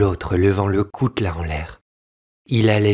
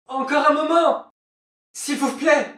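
tiny, very high voice; pleading wife. Acted. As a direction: beg meekly for one moment. Wife begs for delay.

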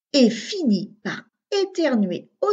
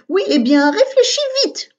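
higher and louder impatient snap; scolding; 10 semitones higher, 5 dB louder than usual. A narrator's reading. Little sister scolds Augustin, impatient snap. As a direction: tell big brother to hurry up. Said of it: "gronda Agathe".

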